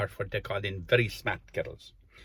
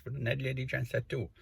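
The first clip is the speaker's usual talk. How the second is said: ordinary talk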